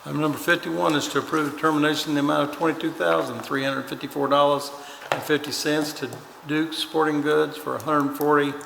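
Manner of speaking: usual speaking voice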